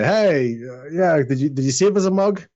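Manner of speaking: high, loud guard call; cheery off-duty guard calling over; calling out to barman. Acted. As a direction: shout across the tavern for a mug.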